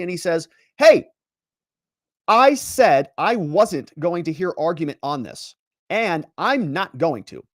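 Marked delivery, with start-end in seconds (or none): 0.8-1.1 loud high bark of a judge; angry shout. Retold or imitated. Judge shutting down the defense lawyer, loud shout. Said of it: "just got blasted". 2.3-7.4 slow, firm and measured; stern slow rebuke. Retold or imitated. Judge refusing to hear argument, slow and stern. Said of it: "just got blasted".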